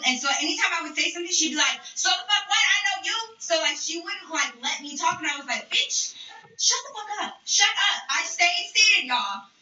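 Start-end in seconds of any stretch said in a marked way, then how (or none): 2.0-3.2 high, combative retort; confrontational rival. Retold or imitated. Acted rival cutting her off. 5.7-8.0 high, insistent retold outburst; angry retort. Acted quarrel, silencing the rival.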